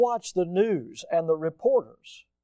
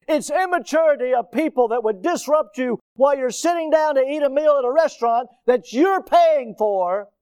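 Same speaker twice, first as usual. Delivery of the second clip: raised, high preaching voice; impassioned denunciation; 7 semitones higher, 6 dB louder than usual. Real, not acted. Preacher denouncing diners being disrupted, impassioned. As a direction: build indignant force, loud and high.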